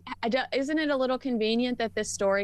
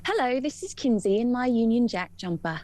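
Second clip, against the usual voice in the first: mock-English, Eliza Doolittle style; put-on English accent; 3 semitones higher than usual. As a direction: copy an English accent like an elocution pupil. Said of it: "Can we do an English one?".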